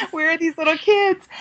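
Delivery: high, giddy mock question; bursting to meet them; excited high squeal of a question; eager question. Eager asking for kids.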